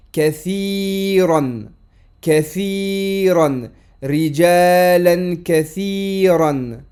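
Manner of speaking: Kathiran slow with the final an sounded; drawn-out demo of Rijalan kathiran; careful teacher model of Kathiran; slow model reading. Shown as a demonstration. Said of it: "tanwin fatha qui s'écrit toujours sur un alif".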